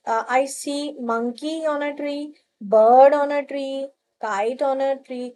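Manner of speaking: put-on each word said slowly and clearly for children to copy; slow model answer. Shown as a demonstration. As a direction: spell out the sample sentence slowly. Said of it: "तो आप क्या लिख सकते हो?". (बच्चों के लिए धीरे-धीरे उदाहरण वाक्य)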